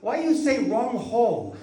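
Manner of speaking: high, broken-English Chinese boss; puzzled factory owner in mock accent. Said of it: "he goes".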